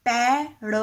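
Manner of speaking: पेड़ said loud and firm; पेड़ punched loud; emphatic. Shown as a demonstration. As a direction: stamp the word पेड़ hard. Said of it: "नहीं बनेगा कभी भी".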